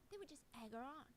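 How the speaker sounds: ordinary delivery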